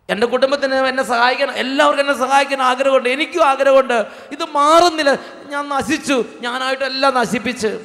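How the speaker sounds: a man begging the priest, voice rising in anguish; anguished plea. Retold or imitated. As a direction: pour out a drunkard's despair, pitch climbing. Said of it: "ആ ചേട്ടൻ പറഞ്ഞു".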